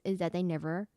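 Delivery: usual speaking voice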